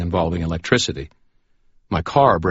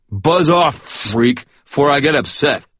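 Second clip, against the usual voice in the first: put-on higher, louder drunken snarl; angry drunk threat; 9 semitones higher, 7 dB louder, slower than usual. A narrator's reading. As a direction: warn him off before you lose it. Drunk Chuck threatens with wrench.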